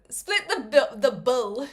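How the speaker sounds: put-on light New Zealand accent on "split the bill", the vowel in "bill" pushed toward "bull"; a playful stab at a Kiwi accent. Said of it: "that was my Kiwi accent".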